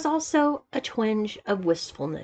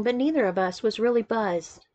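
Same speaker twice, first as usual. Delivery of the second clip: plain reading voice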